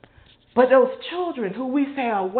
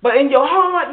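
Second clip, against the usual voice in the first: usual speaking voice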